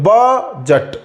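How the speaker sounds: बजट with the first syllable ब stressed and drawn out. Shown as a demonstration. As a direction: slowly split बजट, leaning on the opening ब. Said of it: "पहले वाले syllable पर stress है". (बजट के पहले syllable ब पर ज़ोर, धीरे-धीरे)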